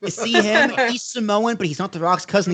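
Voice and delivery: high-pitched, animated explaining; eager pointing-out. Retold or imitated. Retelling explaining Samoan wrestlers to family, eager high voice.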